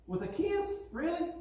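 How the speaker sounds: high, disbelieving rise on kiss and really; Jesus asks his betrayer in disbelief, high and incredulous. Acted. Jesus to Judas, disbelieving and hurt, voice jumping high. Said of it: "can't you just hear Jesus saying".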